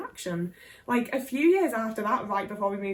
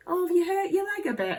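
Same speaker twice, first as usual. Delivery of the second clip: mock doctor; 8 semitones higher than usual. Mocking the doctors' dismissive tone.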